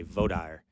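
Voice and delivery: vaudire said as voh-DIRE; Texas pronunciation. Shown as a demonstration. Host explains Texas usage, vaudire as voh-DIRE. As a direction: vaudire with no r in voh, ending like fire. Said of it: "And in Texas".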